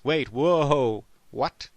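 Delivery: loud, shocked whoa what; startled outburst. Acted. Told to clear his mind, he yells in shock. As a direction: jolt back as if insulted. Reacting to the meditation advice.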